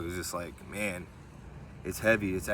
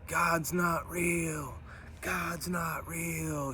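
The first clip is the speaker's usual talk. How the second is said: mocking yell; 8 semitones higher, 3 dB quieter, much slower than usual. A lady across the street taunting believers, held-back mock yell. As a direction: imitate a distant taunting shout, stretching each word. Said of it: "She's yelling this. She's like".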